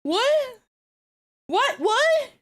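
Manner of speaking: high-pitched, baffled "what?"; real bewilderment, voice jumping high. Real, not acted. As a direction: pitch it way up in confusion. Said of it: "I'm really confused".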